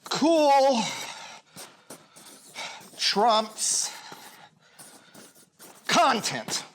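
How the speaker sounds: Cool, trumps, content, each word set apart with long pauses; slow emphatic motto. Shown as a demonstration. Acting teacher announcing his motto to the class. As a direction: drop each word like a commandment. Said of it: "Listen to this".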